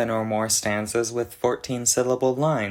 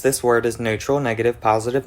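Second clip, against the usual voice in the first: usual speaking voice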